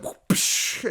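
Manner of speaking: voiceless whoosh and sharp "psh"; imitated whip crack. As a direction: mimic a whip cracking with your mouth. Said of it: "he does his whip sound".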